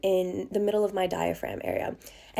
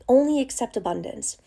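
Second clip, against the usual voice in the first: ordinary talk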